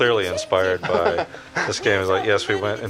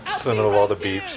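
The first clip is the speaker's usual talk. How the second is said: ordinary talk, nothing marked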